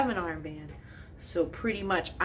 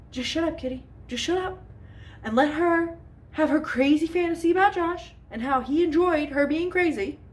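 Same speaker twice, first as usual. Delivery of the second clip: exasperated self-talk; 8 semitones higher than usual. Retold or imitated. Telling herself to stay quiet.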